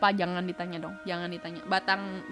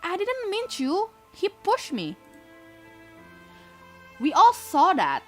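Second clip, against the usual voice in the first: put-on high girl voice; 11 semitones higher, 5 dB louder than usual. Narrator voicing girl.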